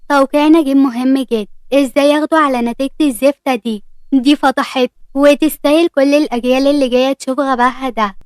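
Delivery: ordinary delivery, nothing marked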